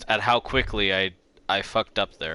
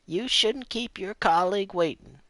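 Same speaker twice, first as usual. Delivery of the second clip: imitating the lady; 10 semitones higher than usual. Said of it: "That's a bit better".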